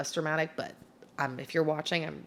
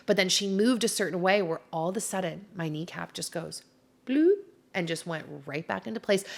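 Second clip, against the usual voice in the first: ordinary talk, nothing marked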